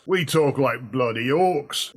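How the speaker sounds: slow, lightly Cockney orc growl-free drawl. Acted. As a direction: talk like a bloody Cockney orc, slowly. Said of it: "Cockney accent for orcs".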